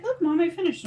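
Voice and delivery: lightly voiced excited child; a hint of a proud little kid; Naomi. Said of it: "Naomi came up to me".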